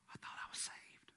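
hushed aside to oneself; whispered self-doubt. Acted. New believer who just said a bad word, whispering. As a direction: whisper it as a private, sinking doubt. Convert's private doubt after swearing.

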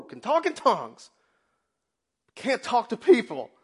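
high, loud preaching cry; fiery preaching. Acted. Preacher crying out to the congregation. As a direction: preach it hard to the church.

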